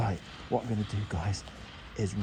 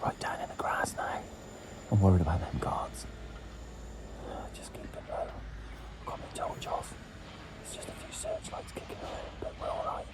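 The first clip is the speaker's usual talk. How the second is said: hushed, voiceless whisper. Real, not acted. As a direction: whisper so the guards can't hear. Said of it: "Sorry I have to whisper".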